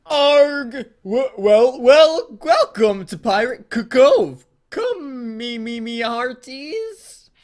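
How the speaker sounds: high, loud broken pirate robot growl; a glitching animatronic pirate, hammed up and stuttering.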